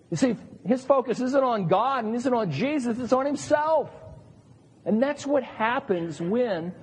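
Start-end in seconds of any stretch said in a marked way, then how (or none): none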